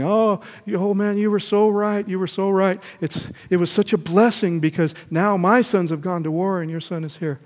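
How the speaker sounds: loud, high sing-song of the crowd; gloating villagers. Acted. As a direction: villagers crowing over the old man. Villagers lament, then gloat.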